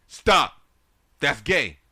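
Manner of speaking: high-pitched, very loud mock scream; Kevin Hart. Repeating a comedian's stage joke, screamed out. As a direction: shout each short phrase as a punchline. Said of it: "and scream in his voice".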